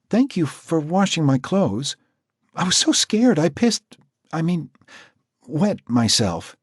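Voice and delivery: put-on high, halting girl's voice; shy frightened girl. Marcy thanking Jack shyly, high and halting. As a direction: falter like an embarrassed girl.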